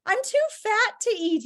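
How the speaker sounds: high-pitched anxious plea; high, whiny bargaining; begging. Acted. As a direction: plead your way out of being eaten. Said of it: "started begging".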